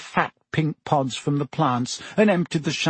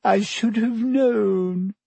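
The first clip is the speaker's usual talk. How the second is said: high, slow, tragic lament; 8 semitones higher, much slower than usual. A narrator's reading. Lavender mourns her rabbit, high and tragic. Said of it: "said Lavender tragically".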